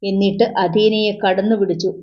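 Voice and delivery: ordinary delivery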